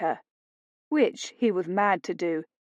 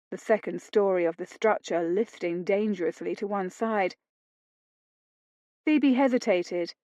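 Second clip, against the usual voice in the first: ordinary delivery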